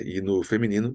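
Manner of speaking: ordinary delivery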